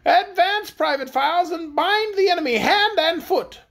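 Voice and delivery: high-pitched, imperious order; angry royal command. A narrator's reading. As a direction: order the soldier forward in a high queenly voice. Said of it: "retorted the Queen angrily".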